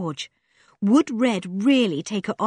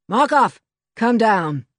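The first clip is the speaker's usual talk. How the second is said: louder call up through the ceiling; calling loudly; 4 semitones higher, 6 dB louder, slower than usual. A narrator's reading. Red summons his man from above, calling loudly. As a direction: shout it up to the hole above. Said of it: "He suddenly called loudly".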